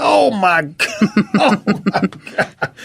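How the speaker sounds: high, laughing "oh my God"; shocked laughing. Real, not acted. Host recalling stunned reaction to the film, laughing. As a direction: gasp it out in shocked delight.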